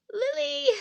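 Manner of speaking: very high, loud call. Acted. Calls out for Lily.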